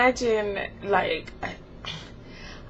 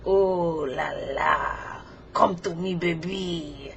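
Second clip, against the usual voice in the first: put-on slow, low French-accented seduction; Frenchie; 5 semitones lower, much slower than usual. Frenchie at a hug, seductive French accent. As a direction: purr a come-on in a French accent. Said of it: "like, a little French accent".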